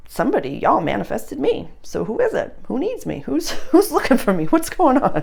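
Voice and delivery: high, lively, laughing questions; excited searching. Retold or imitated. Waitress recalling first day looking for her people, excited searching. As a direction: eagerly look around for who called you.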